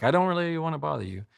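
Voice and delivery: high, fast, apologetic; mock timid apology; timid hesitation. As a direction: sound like you're afraid to bother them. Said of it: "as if, like".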